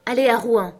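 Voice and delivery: ordre joyeux. A narrator's reading. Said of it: "quelle joie de pouvoir dire".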